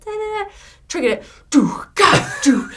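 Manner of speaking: high sung-out riff, then loud drum hits; vocal kick-and-snare blasts; imitated sounds. Musician mimicking a clip misfiring mid-song, loud imitated sounds.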